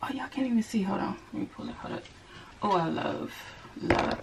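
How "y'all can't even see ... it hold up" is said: ordinary talk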